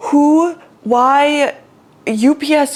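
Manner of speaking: retold frustrated outburst at the delivery guy; exasperated rant. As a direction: splutter with exasperation. Ranting at UPS.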